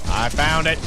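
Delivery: loud and emphatic. Real, not acted. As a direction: call it out over your partner. Repeats it louder over partner.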